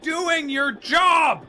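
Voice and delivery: high, slow, shouted retort; exasperated shout. Acted. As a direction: yell it in disbelief.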